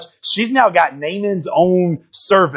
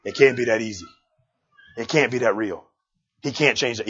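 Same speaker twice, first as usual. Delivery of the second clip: doubter; 7 semitones lower, 7 dB quieter than usual. Retold or imitated. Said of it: "we're like".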